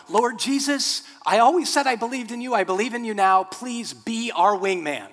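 mock terrified passenger praying; mock panicked prayer. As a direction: beg God like the plane is going down. Passenger prays as wing falls.

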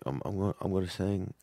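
soft shy mumble. Retold or imitated. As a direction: barely dare to say it. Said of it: "and he's like".

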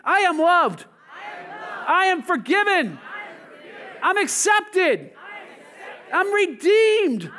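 loud, high, slow declarations building to a shout; passionate shout. Shown as a demonstration. Preacher leading the congregation in a passionate shout. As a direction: shout each line with full passion. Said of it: "Say it with a little more passion".

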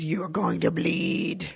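slow, low, drawn-out menace; Batman. Retold or imitated. Podcaster quoting the trailer's Batman threat, slow and menacing. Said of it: "this Batman goes".

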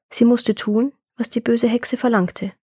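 ordinary delivery

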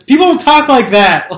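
loud, high-pitched protest; exasperated objection. Real, not acted. Criticising the film's dialogue, exasperated. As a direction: protest it, voice jumping way up.

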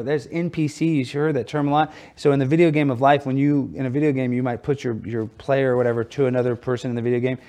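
ordinary delivery

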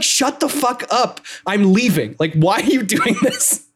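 high-pitched mock exasperation, breaking into laughter; hammed-up fed-up protest with stretched words; exasperated outburst. Edelgard tells Ferdinand she is leaving, in mock exasperation.

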